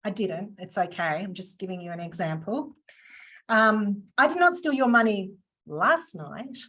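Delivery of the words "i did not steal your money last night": "last night" set apart and swooping at the end; stress on LAST NIGHT. Shown as a demonstration. Trainer hints it was another time, stress on "last night". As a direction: hold back, then lean on "last night". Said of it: "just changing the inflection on that word".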